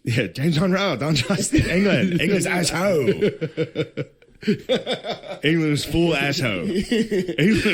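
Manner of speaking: laughing, hammed-up Irish accent; mock Irish accent. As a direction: ham up a thick Irish accent. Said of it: "in that fucking Irish accent".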